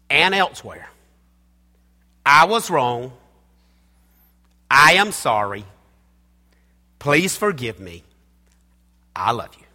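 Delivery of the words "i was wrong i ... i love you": each sentence set apart with pauses; spaced-out key phrases. Shown as a demonstration. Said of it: "Four three-word sentences".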